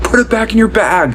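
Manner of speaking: high-pitched imitation of a yelled order; mock yelling. Imitating TSA agent ordering another passenger, mock yelling. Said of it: "got yelled at in the same way".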